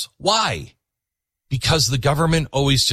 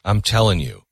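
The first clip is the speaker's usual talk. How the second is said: earnest appeal; 6 semitones lower, slower than usual. Real, not acted. Host closes with earnest appeal.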